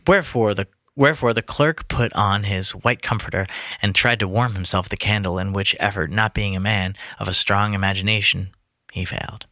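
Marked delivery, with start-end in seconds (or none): none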